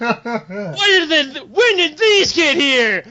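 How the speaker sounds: high, slow, laughing disbelief; laughing panic. Retold or imitated. Player spots a crowd of Worgen outside, laughing in high panic. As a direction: squeal through laughter at the sudden mob. Sees fifty Worgen at door.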